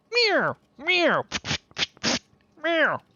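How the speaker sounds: very high, loud meowing; cat meow. Pretend. Streamer meowing playfully.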